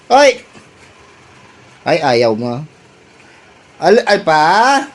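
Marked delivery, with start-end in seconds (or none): 0.1-0.5 calling out. Real, not acted. Calling out loudly.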